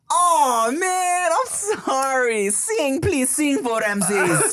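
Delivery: high, slow, pleading apology; apologetic high voice. Retold or imitated. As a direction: beg him to sing again. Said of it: "I was like".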